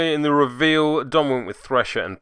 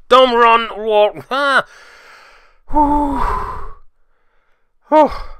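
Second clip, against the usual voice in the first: silly exhausted cries, much higher and louder; giddy tired outburst; 12 semitones higher, 9 dB louder, much slower than usual. Real, not acted. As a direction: blurt it out like you've lost it after a long day. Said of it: "I've been at seven hours of this already, can you tell?".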